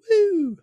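very high, loud woo; real high-pitched whoop; celebratory whoop. Real, not acted. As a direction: cheer for the newlyweds. Cheering a friend's wedding.

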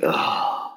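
whispered let-down groan; a deflated, whispered ugh; disappointed ugh. Retold or imitated. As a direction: sigh out a whispered ugh of letdown. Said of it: "you're just like".

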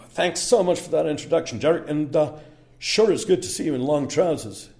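hammed-up American accent, cheerful and loud. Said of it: "where that accent came from".

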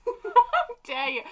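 high, drawn-out, laughing indignation; slow, high-pitched laughing protest; laughing mock outrage. Real, not acted. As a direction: play offended while cracking up. Mock offence at friend's joke.

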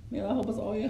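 ordinary talk, nothing marked

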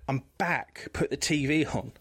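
ordinary talk, nothing marked

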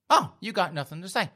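loud, high goading; angry taunt. Acted. Girlfriend goading her silent boyfriend, angry taunt. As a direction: needle him for his silence.

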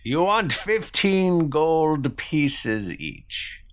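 put-on high, slow quest-giver accent; Granona. Quest giver repeats the players' price, high drawn-out accented voice. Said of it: "if this was my accent, but it is now".

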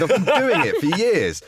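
hammed-up exasperation, stretching the words; mock indignation. Patient scoffing at the doctor's breathing sheet, mock indignant.

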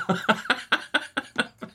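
airy giggle, high; breathy laugh. Real, not acted. Laughing after intro.

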